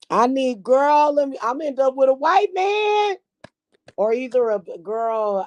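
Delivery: high-pitched excited confession; excited outburst. Real, not acted. Excited confession about men.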